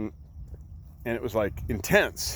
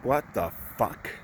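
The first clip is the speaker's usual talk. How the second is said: stunned hushed reaction; 2 semitones lower, 5 dB quieter than usual. Retold or imitated. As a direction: drop it quietly in disbelief. Couple reacts to price.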